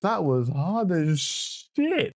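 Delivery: high, slow, stretched-out exclamation; hyped rave. Real, not acted. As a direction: draw out the last word in sheer hype. Hyped reaction, repeats the praise.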